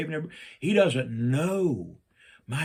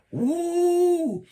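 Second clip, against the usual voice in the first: whoop; 4 dB louder than usual. Real, not acted. Whoop after painting eyelashes.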